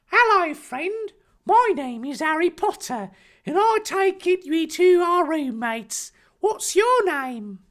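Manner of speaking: high, slow, hammed-up brave boy; plucky high-pitched hero, overdone; Harry Potter. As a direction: declare it bravely in a drawn-out put-on voice. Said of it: "declared bravely".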